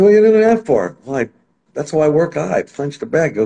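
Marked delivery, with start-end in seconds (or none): 0.0-1.0 incredulous question. Retold or imitated. Retold quote to fighter.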